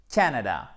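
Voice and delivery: Canada with the first syllable punched, then falling; stress on first syllable. Shown as a demonstration. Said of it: "Accent tonique sur la première syllabe".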